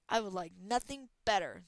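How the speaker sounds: loud roaring reply; roared. Acted. Dragon roars at Talon, loud. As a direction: bellow it back. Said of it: "the dragon roared".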